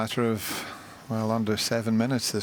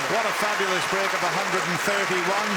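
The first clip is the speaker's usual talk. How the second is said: excited commentary; 10 semitones higher than usual. Real, not acted. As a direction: celebrate the break with full voice. Acclaiming the 131 break.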